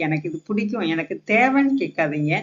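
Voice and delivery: ordinary talk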